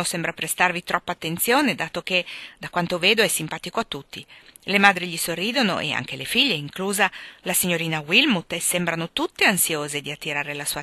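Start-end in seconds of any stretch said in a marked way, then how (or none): none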